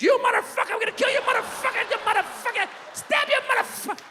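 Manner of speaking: very high, screechy cursing fit; hammed-up high-pitched death threats; mock furious rant. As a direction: lose it completely at the kids. Acted furious parent cursing at kids.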